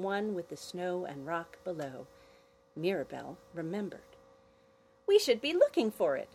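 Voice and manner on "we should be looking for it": put-on higher, louder scolding girl; chiding. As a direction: chide her sister. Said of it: "she chided her sister".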